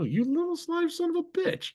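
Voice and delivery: high, put-on voice hurling a playful insult; hammed-up high insult; mock outrage. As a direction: jeer at the thief in jest.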